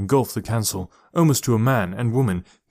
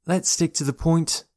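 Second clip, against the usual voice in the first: higher-pitched, mildly exasperated; frustrated; 7 semitones higher than usual. A narrator's reading. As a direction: press them with a touch of frustration. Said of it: "Laurel sighed with frustration".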